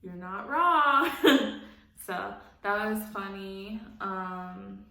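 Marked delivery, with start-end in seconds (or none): 0.0-1.7 higher, louder, slow and laughing; amused, drawn-out agreement. Retold or imitated. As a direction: crack up while agreeing. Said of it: "And I'm like".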